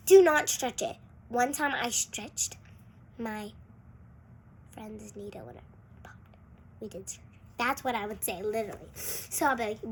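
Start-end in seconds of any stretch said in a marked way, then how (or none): 0.1-1.0 louder firm warning, higher; telling a friend not to stretch it, loud and firm; stern warning. Pretend. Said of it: "I'm like". 4.7-7.4 hushed confession, much softer; admitting she popped a friend's squishy, whispered. Real, not acted. Confessing a secret.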